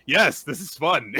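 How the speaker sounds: loud, eager acted answer; gleeful admission.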